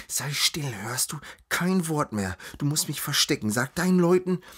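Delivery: ordinary delivery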